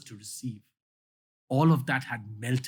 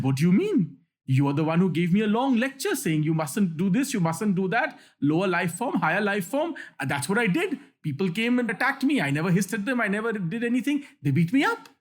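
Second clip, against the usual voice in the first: high-pitched storyteller's protest; retold indignant retort; 6 semitones higher than usual. Beaten snake answering back to the monk, retold indignation. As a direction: protest as the wronged snake, lightly acted. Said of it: "the indignant snake spoke back".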